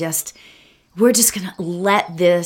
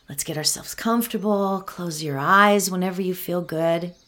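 guided meditation; 6 dB quieter than usual. Acted. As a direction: ease them into calm, one breath at a time.

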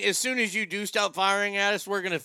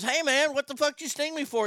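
higher, mock-aggrieved protest; indignant frog; 7 semitones higher than usual. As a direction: protest the sting in a raised pitch.

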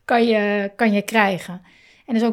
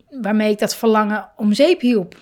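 ordinary delivery

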